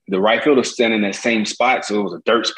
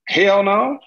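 mock Jordan; 10 semitones higher than usual. Jordan's blunt refusal voiced.

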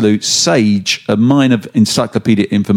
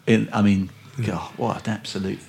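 trailing off; 5 semitones lower, 10 dB quieter than usual. Real, not acted. Trailing off in disgust.

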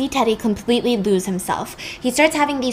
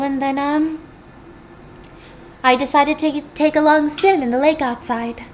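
pitched-up, fretful housewife; Rachel's voice; 5 semitones higher than usual. Retold or imitated. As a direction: chatter nervously in a higher voice. Rachel answers the police.

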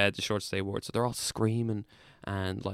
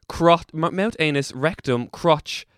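loud, raised-pitch roll call; emphatic listing; 7 semitones higher, 9 dB louder, slower than usual. Shown as a demonstration. Patient reciting swab sites to host, loud emphatic listing. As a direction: punch out each body part, high and loud.

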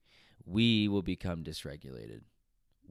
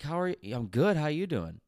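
imitated pushy stranger, high and loud; mock-friendly, impatient comeback; pushy retort; 10 semitones higher, 8 dB louder than usual. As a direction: throw the pleasantry back loudly, wanting them to move. Retold curt reply from pushy stranger.

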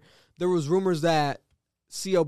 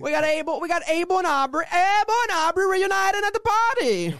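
high, rising louder caricature of a Canadian; mock Canadian accent; 13 semitones higher, 4 dB louder than usual. Announcing [name] and [name]'s reunion, in a loud high mock Canadian accent. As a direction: build a hammed-up Canadian announcement to a big finish. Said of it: "The Canadian".

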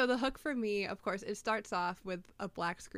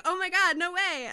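high, loud thrilled shriek; shrieking jackpot winner; 11 semitones higher, 9 dB louder than usual. Retold or imitated. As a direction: squeal like you just won big. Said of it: "I was like".

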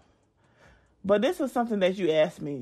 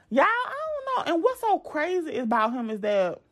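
usual speaking voice

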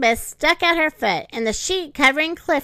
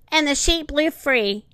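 usual speaking voice

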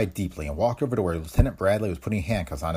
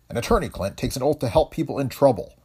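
stern; 6 semitones higher than usual. A narrator's reading. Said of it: "His voice grew stern".

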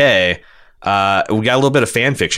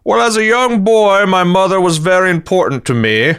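higher, stately Picard voice; Patrick Stewart impression; 9 semitones higher than usual. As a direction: deliver it like a grand English starship captain. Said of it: "this is my Patrick Stewart".

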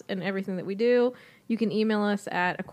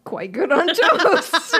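laughing; 6 semitones higher, 7 dB louder, slower than usual. Real, not acted. Laughing repeat of joke.

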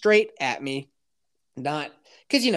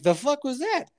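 voicing startled dogs; 10 semitones higher than usual. Dogs voiced reacting to mace.

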